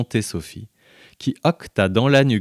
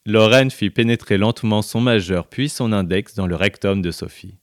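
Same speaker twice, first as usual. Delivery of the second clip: plain reading voice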